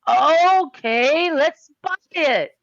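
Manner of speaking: loud, high, drawn-out eagerness; big eager buyer's turnaround; excited giving-in. Acted. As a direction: swing up high and stretch it out. Won over after hearing spell's power.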